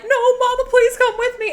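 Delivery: high, loud mock pleading; imitating John. Son begging his mama to come along, mock pleading. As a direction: beg in a put-on high kid voice. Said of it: "And John's all like".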